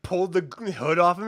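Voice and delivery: usual speaking voice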